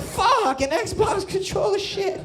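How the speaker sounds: stoned viewer freaking out; shocked outburst. Retold or imitated. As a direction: yell it in disbelief at the TV. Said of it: "I'm like".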